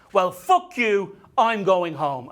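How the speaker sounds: loud, slow, acted fury; angry outburst. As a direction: storm off with a loud parting shot. Acted husband storming off from fight.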